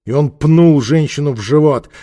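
usual speaking voice

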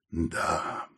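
low, soft, stretched-out word; drawn-out. A narrator's reading. Саул looks over the strange machine, drawling softly. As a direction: draw it out quietly and low. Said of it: "протянул Саул".

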